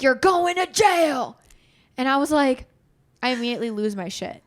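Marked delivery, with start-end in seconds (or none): 0.0-1.4 high, loud, slow shouted threat; a father teasing his daughter with jail; mock stern threat. Dad threatening kid in story.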